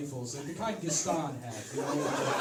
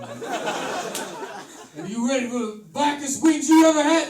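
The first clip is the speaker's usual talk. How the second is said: high, loud, slow showman voice; loud hammed-up announcer; Le John hype; 11 semitones higher, 15 dB louder, slower than usual. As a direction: hype it like a cartoon pitchman. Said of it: "what if I was Le John".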